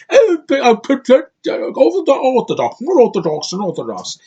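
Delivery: mocking an actor's thick Cockney accent. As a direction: ham up a thick Cockney accent like a cartoon character. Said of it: "This very thick Cockney accent".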